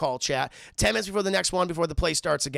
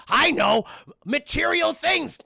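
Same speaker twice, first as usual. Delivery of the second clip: eureka excitement; 9 semitones higher, 6 dB louder, slower than usual. Retold or imitated. Fred hits on a bad idea.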